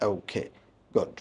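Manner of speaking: ordinary talk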